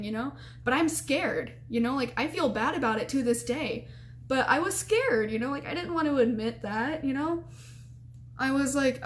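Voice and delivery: ordinary delivery, nothing marked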